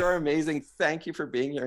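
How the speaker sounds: half-yelled thanks to a stranger; mild retold yell. Runner thanking a lady on the course, mild retold yell. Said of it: "I'm yelling at her".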